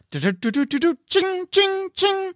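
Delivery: high, ringing slot-machine noises; imitated coin jingle. Game master voices gambling god's spell landing, high jingling noises. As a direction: sing out a gambling payout sound effect. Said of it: "Harry Potter, you hear".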